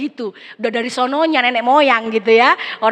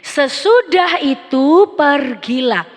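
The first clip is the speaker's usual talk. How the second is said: slow, loud verse reading; scripture reading; 4 semitones higher, 6 dB louder, much slower than usual. Acted. Preacher reading the verse slowly and loudly to the congregation. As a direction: read the verse out slowly to the church.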